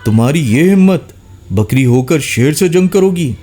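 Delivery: growling lion. A narrator's reading. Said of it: "غرا کر اٹھا".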